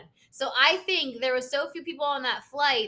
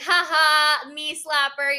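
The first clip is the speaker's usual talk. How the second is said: mock sarcastic laugh; 3 semitones higher, 7 dB louder, much slower than usual. Sarcastic reply to friend's bad news.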